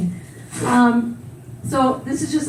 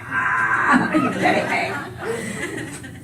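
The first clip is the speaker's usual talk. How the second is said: laughing mock complaint; 3 dB quieter, much slower than usual. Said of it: "So I'm like".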